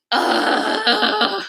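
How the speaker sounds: high exasperated "ugh" groan; frustrated groan. Real, not acted. Phone still broken, frustration.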